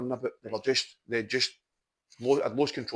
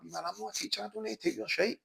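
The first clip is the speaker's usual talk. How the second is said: mock-threatening a pupil, pitched higher and quieter; joking tough talk; 8 semitones higher, 5 dB quieter than usual. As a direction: fake menace with a wink, lightly played. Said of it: "lay it down kind of joking".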